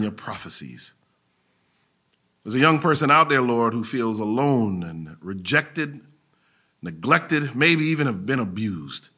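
prayer. Acted. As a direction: pray over them gently. Leads the closing prayer.

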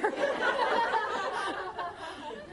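high embarrassed giggle breaking out; a burst of real giggling; real laugh. Real, not acted. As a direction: laugh helplessly at the mishap. Embarrassed by latecomer mishap.

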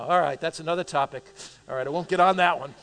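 usual speaking voice

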